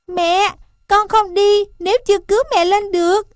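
high, quick, insistent calf voice; a small child digging in its heels, pitched high; determined baby elephant. A narrator's reading. Calf refuses to run until mother is saved, high determined plea.

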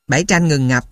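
ordinary delivery

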